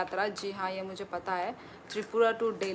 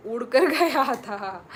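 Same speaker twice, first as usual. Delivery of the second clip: उड़कर गया था said louder and slower, laughing in the middle; laughing retake; 3 semitones higher, 8 dB louder, slower than usual. Real, not acted. Host repeating the joke to viewers with a laugh.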